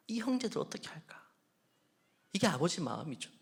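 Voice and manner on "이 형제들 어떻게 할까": whispered, higher, grieving father; father's broken whisper to himself; anguished whisper. Retold or imitated. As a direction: breathe it out in despair. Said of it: "속에서 불이 탔을 거예요".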